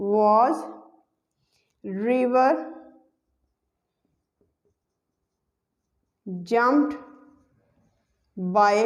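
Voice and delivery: single words set apart by long pauses. Shown as a demonstration. Teacher building the passive sentence for students, word by word. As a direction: lay down each word on its own, waiting between.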